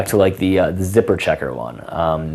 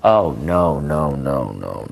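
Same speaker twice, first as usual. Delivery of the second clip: dramatic disappointment; 4 semitones lower, much slower than usual. Shown as a demonstration. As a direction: let the no's sink into despair. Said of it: "it's dramatic".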